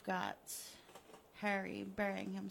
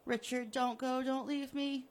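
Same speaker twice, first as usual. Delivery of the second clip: louder, desperate begging; pleading victim; 4 semitones higher, 7 dB louder than usual. Acted. Buried Harry begging Richard to stay, desperate. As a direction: beg him not to leave.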